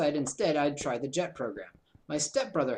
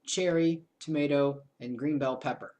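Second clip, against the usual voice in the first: ordinary talk, nothing marked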